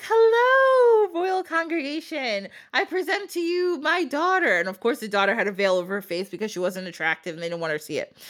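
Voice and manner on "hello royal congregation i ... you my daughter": a high, loud, sugary greeting that slows down; fake-sweet stepmom. Scheming stepmom presenting her daughter to the royals, high and fake-sweet. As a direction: put on a showy hostess voice.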